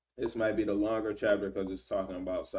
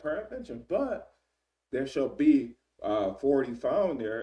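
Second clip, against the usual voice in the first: Abraham's plea, pitched up and loud; a mock-timid bargainer pushing his luck; high pleading; 4 semitones higher, 5 dB louder than usual. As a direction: beg the Lord in a raised, nervous voice.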